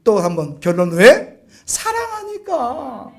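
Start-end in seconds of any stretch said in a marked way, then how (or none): 1.6-3.1 tender slow refrain. Real, not acted. Repeated refrain, first take.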